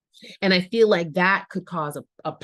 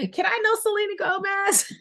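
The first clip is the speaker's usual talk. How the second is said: high-pitched squeal of longing; gushing fan plea; 14 semitones higher, faster than usual. Real, not acted. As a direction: plead like a starstruck fan, voice way up. Gushing fan plea amid laughter.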